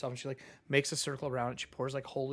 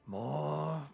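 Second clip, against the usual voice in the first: low, slow, breathy demon whisper; sinister whispered gloating; creepy creature whisper; 6 semitones lower, 7 dB quieter, slower than usual. Acted. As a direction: hiss it like something hungry. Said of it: "you hear the creature go".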